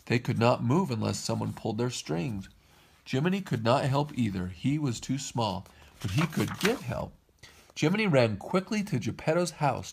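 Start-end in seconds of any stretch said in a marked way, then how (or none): none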